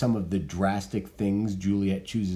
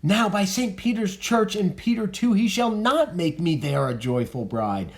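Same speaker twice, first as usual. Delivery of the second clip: high, loud, indignant protest; outraged refusal; 8 semitones higher, 4 dB louder than usual. Acted. Juliet refuses her mother's wedding plan, high and indignant. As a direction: Juliet rejects the wedding, louder and higher. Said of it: "she's about to freak out here".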